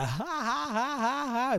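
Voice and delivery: high, hammed-up ha-ha laugh; mock belly laugh. Showing his co-host how he laughed, mock belly laugh. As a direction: play a laughing Buddha. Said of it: "like a fucking laughing Buddha".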